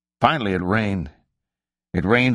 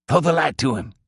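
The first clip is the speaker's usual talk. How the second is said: higher-pitched call across a field; 7 semitones higher than usual. Acted. Hunter to his partner on spotting a figure in the field, calling out.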